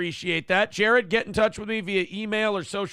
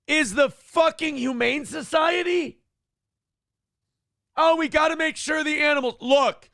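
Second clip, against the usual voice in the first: angry rant; 5 semitones higher than usual. Real, not acted. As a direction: tear into her, exasperated. Ranting at GoFundMe organizer.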